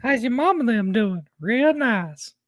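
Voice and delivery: high, swooping small talk; mock-folksy greeting. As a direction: coo it with exaggerated swoops. Mock-folksy greeting echoing the co-host.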